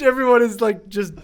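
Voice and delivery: ordinary talk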